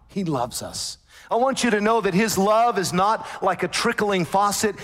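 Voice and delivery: normal talking voice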